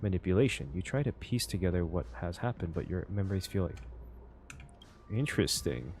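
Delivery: reading the game's narration like a storyteller; story narration. A narrator's reading. Reading the game's text aloud to viewers, storyteller narration. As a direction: narrate it evenly, drawing the listener in.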